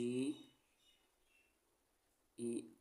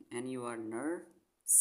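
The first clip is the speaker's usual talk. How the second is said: slow spelling out; much slower than usual. Shown as a demonstration. Teacher spells nurse slowly for young readers. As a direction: slowly sound out एन यू आर, then nurse. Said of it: "spelling out slowly".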